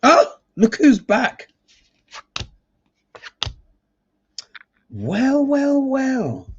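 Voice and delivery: high, slow, drawn-out "well, well, well"; knowing mock greeting. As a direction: tease the card like an old rival come home. Reader greets a returning card.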